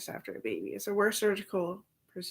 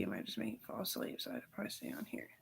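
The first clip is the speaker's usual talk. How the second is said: trailing off; 11 semitones lower, 11 dB quieter, faster than usual. Real, not acted. Voice drops away.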